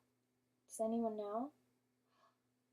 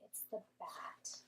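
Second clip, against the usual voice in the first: slow hushed whisper; 4 semitones lower, 11 dB quieter than usual. Real, not acted. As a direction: lean in and whisper it. Teacher aside to class.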